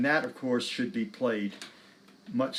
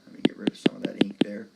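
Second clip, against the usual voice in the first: whisper; 3 semitones lower, 3 dB louder, faster than usual. Real, not acted. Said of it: "whispered aside while erasing".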